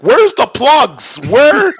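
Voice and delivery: slow, loud, high whiny demand; mock whiny camper. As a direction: whine it like a spoiled camper. Mocking her at the campsite.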